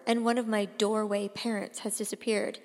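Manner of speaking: plain reading voice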